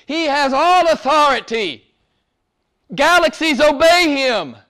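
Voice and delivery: high, ringing preacher's proclamation; full-force preaching. Acted. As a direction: declare it to the congregation, high and emphatic.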